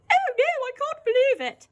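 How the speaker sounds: high, loud shocked cry; shocked wife. Shown as a demonstration. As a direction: cry out in dismay. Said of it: "let's have a look at this scene".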